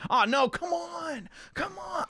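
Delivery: high, drawn-out complaint; whining protest. Real, not acted. Complaining at a cliffhanger.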